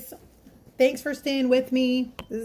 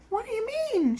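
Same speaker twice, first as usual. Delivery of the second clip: high puzzled question; waitress surprised that the customer came back; 14 semitones higher, faster than usual. Retold or imitated. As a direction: caught off guard.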